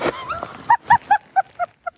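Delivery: high burst of laughter; laughing hard. Real, not acted. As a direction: crack up after falling. Fell in the snow, laughing hard.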